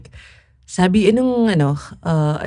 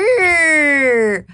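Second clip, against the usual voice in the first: mocking yell; 7 semitones higher, 4 dB louder than usual. Teasing friend about step count.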